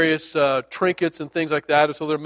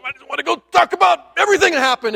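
much higher, quick, bursting to talk; eager rush of retold excitement; fired up; 9 semitones higher, faster than usual. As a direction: blurt it out, barely holding back. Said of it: "like, fired up".

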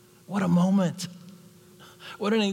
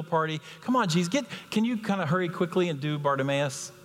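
higher-pitched, exasperated pleading; impatient urging; 5 semitones higher than usual. Acted. Disciples want Jesus to get this over with, impatient. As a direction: hurry him along, mildly fed up.

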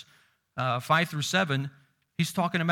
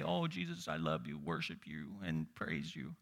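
much lower, much softer mock praise; sarcastic imitation of rote worship, low and soft; mock hollow worshipper; 12 semitones lower, 12 dB quieter than usual. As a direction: mumble the praise like empty lip service. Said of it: "when we come and say".

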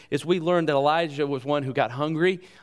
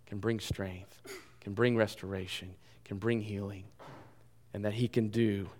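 low, soft, earnest preaching; hushed pastoral appeal; 5 semitones lower, 7 dB quieter than usual. Real, not acted. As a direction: bring the sermon down to a gentle, quiet close. Hushed closing appeal of the sermon.

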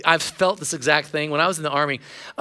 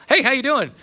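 higher, cheerful hello; chipper greeting; 7 semitones higher than usual. Retold or imitated. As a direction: greet him brightly. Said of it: "feeling chipper".

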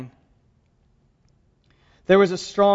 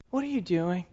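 softer, hushed second asking; gentle repeat; 9 dB quieter than usual. Retold or imitated. God gently asks the discouraged prophet again, hushed and soft. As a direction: ask it again gently, almost under the breath.

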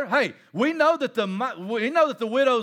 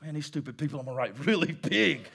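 muttering Paul; 7 semitones lower, 5 dB quieter than usual. Acted. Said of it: "and he's like".

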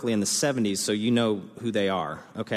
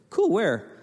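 much higher, quick keen question; high perky follow-up; eager interest; 10 semitones higher, 4 dB louder than usual. Retold or imitated. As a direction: sound thrilled to hear it. Retold excited wedding small talk.

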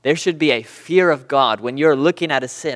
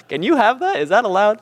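high-pitched, incredulous questioning; startled disbelief; 8 semitones higher than usual. Retold or imitated. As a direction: act out a newcomer's astonished questions. Acts out his startled thought.

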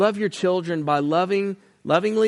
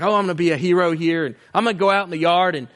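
eager dad; faster than usual. Retold or imitated. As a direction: rattle it off like a dad who has a plan. Dad boasting he will rescue.

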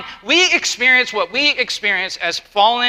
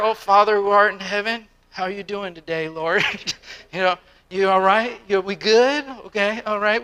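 timid flattery. Retold or imitated. Mocks a nervous flattering prayer.

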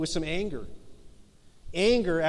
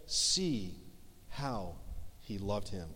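fading to almost nothing, slow and soft; hushed reverent reading; 10 semitones lower, 11 dB quieter, slower than usual. Acted. Pastor reading the mourners' words from John, fading hush. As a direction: let each word sink quieter than the last.

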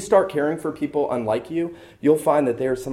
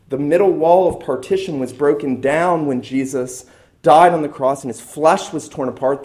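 building preaching; 3 semitones higher, 6 dB louder than usual. Real, not acted. Preacher rising to climax.

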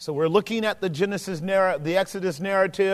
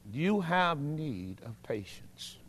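slow soft repeat; 3 semitones lower, 10 dB quieter, slower than usual. Shown as a demonstration.

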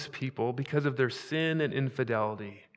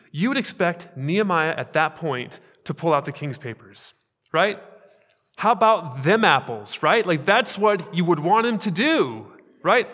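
energetic preaching; 3 semitones higher, 7 dB louder than usual. Acted. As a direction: drive the point home to the congregation. Preaching building up.

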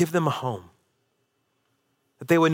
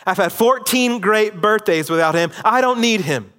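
raised, higher and louder, defiant and wounded; hurt angry outburst; 6 semitones higher, 4 dB louder than usual. Retold or imitated. Will yelling at his uncle that he doesn't need his father, angry and hurt. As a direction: throw it out like a hurt teen proving he doesn't care.

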